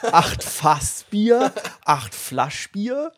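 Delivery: very slow, stretched questions; slow drawn out. Pretend. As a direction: drag each word out slowly.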